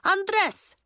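high, loud summons; urgent teacher's call. A narrator's reading. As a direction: call sharply to a pupil. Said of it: "Miz Starr called".

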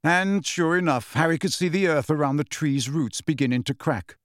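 ordinary delivery

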